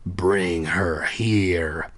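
low, slow commanding alien; deep ordering voice. Acted. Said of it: "I heard a deep voice order".

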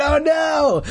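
high shouted oh no; mock horror. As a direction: cry out in fake dismay. Mock horror at worm game.

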